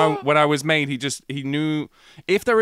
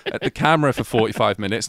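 normal talking voice

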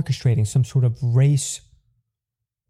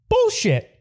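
indignant outburst. Real, not acted. Rant outburst, high pitch.

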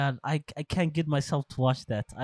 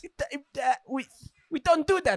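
high pleading mobster voice; Italian accent; 8 semitones higher than usual. Pretend. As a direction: beg like a mob guy. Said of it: "they do a fucking Italian accent on you".

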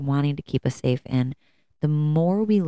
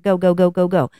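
louder, driving rapid-fire chant; mock pep-up; 3 semitones higher, 5 dB louder than usual. Urging herself to keep doing chores, louder and driving. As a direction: push yourself like a drill sergeant. Retold self-talk pushing through fatigue.

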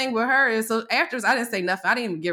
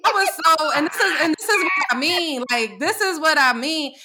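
worked up; 8 semitones higher, 4 dB louder than usual. Real, not acted. Heated aside about the boss.

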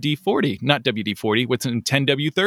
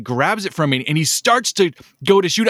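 ordinary delivery

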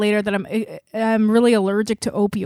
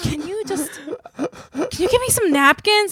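high, halting mock-desperate request; acted plea; 7 semitones higher than usual. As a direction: beg in a raised, helpless voice. Retold plea to laughing friend.

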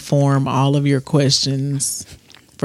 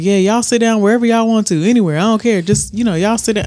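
higher, fast, loose mock of DC; lounging DC impression; 6 semitones higher, faster than usual. Mocking DC sprawled on the couch inviting the girls. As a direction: play him sprawled on the couch, careless. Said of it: "stretched his whole body out on the couch".

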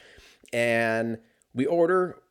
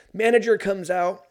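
normal talking voice

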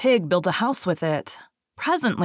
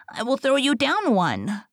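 higher-pitched piglet voice; agreeable little pig; 6 semitones higher than usual. A narrator's reading. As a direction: answer the wolf as a high-voiced pig. Pig up tree answers wolf.

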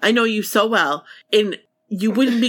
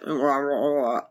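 lower, softer wordless mock protest; groggy grumbling imitation; 7 semitones lower, 5 dB quieter than usual. Imitating a friend told to get up, grumbling. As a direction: grumble like someone who won't get up. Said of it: "you'd be like".

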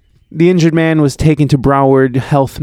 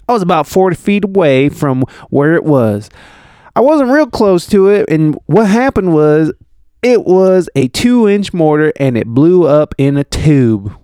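higher-pitched southern witness; southern accent; 4 semitones higher than usual. Acted. Reading Kofi's account of the mortar, southern accent. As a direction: tell it as a southern eyewitness. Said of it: "I'm just gonna do a southern accent".

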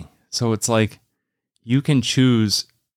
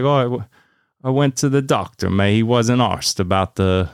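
bad British accent. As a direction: have a go at Liverpool speech, badly. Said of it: "That was not a good accent".

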